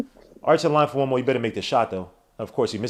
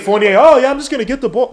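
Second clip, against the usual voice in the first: high, loud, sarcastic complaint; mock the player at raised volume; exasperated mocking; 10 semitones higher, 8 dB louder than usual. Real, not acted. Exasperated at player's lazy play.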